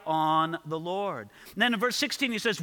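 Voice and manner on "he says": normal talking voice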